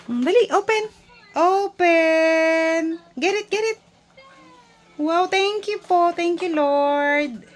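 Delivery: high, loud and excited. Real, not acted. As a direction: gush over the gift. Parent excited over baby's gift.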